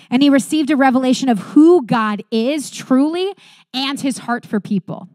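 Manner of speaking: ordinary delivery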